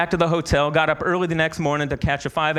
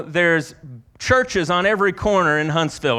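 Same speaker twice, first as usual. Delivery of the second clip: ordinary delivery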